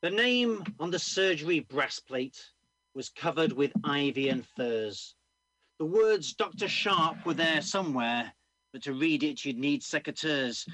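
loud rhyming performance reading; comic poem recital. Acted. Poet performing a comic ballad to the audience, loud recital. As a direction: drive the rhymes home to the room.

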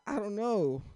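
sheepish high-pitched shrug; clueless high reply. Retold or imitated. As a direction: sound lost with no answer. His imagined clueless reply.

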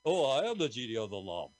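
loud proud genie announcement; Bud Spencer. Retold or imitated. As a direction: declare it like a boastful genie. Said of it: "You do a great Bud Spencer".

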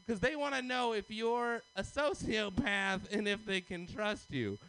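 normal talking voice

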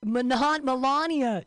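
ordinary talk

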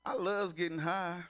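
put-on high sweet old grandma asking; lightly voiced grandma. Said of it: "Ain't that some grandma shit".

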